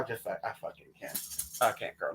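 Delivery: low, soft, breathy give-up; whispered exasperation. Real, not acted. Telling Tina she can't take it, a soft whispered give-up. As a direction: whisper it, completely done. Exasperated about not learning languages.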